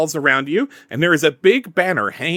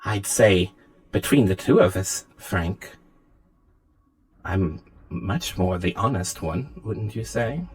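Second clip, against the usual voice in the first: lower, quieter voice with pointed pauses; coolly challenging AI; 5 semitones lower, 3 dB quieter than usual. Acted. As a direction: press the point softly, in a low voice. Game master plays Anna.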